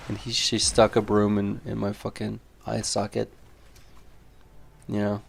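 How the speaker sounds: ordinary talk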